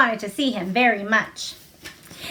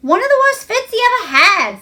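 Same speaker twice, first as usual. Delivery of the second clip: higher and louder, eager to tell; alarmed gossip; 10 semitones higher, 5 dB louder than usual. A narrator's reading. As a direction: burst out with the shocking tale. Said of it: "said Martha".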